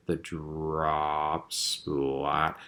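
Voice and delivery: slow-motion effect. A narrator's reading. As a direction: drop splat stretched way out. Slow-motion replay of the fall.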